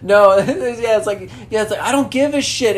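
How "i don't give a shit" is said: lightly acted, raised-pitch defiance; mock angry quote. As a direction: replay the rant at talking volume. Repeats the movie outburst.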